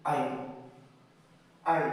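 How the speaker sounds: আই said as a clear aɪ glide; diphthong demo. Shown as a demonstration. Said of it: "small a and capital I এর সমন্বয় কি?". (ডিফথং আই স্পষ্ট করে)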